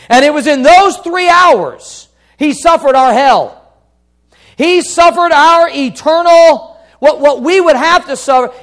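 loud, high-pitched sermon delivery; impassioned preacher at peak volume; full-force preaching. Real, not acted. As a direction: drive it home to the congregation at full voice.

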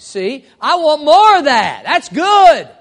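hammed-up kid loving his veggies; eager kid. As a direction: play the excited kid begging for seconds. Voicing kid wanting more veggies.

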